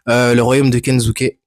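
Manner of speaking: usual speaking voice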